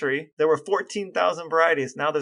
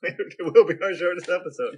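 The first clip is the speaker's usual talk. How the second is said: laughing. Real, not acted. Laughing echo.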